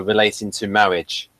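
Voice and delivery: normal talking voice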